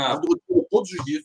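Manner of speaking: high mock praise, dripping irony; sarcastic. Real, not acted. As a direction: say it as an ironic jab. Ironic: the trip was awful. (sarcástico, com ironia)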